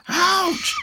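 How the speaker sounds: high, loud ouch; cried ouch. A narrator's reading. Pteranodons hitting Anki's hard back, crying out high and loud. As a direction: yelp as they bounce off armour. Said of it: "cry the pteranodons".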